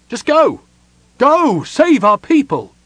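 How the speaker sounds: loud, very high urgent cry; desperate shout. A narrator's reading. Prince sends elves to save his people, shouting. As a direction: send them off with a desperate shout.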